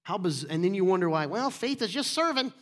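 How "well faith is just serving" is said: high, put-on complainer voice; mock whiny objection; mocking imitation. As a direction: mimic a dismissive churchgoer in a raised pitch. Mocking a complacent churchgoer's attitude.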